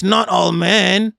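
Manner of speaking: high, drawn-out mock objection; mocking protest. Streamer mimicking men who object to generalisations, mocking protest. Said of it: "when people say all men are trash".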